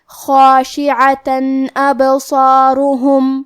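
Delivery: خاشعتن ابصارہم with the نون of tanween said clearly, no nasal hum, slow; slow izhar demo, clear n in خاشعتن ابصارہم. Shown as a demonstration. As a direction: read خاشعتن ابصارہم slowly, sound a clean n before the alif. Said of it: "تنوین ظاہر کریں گے اور اسے نون ساکن پڑھیں گے".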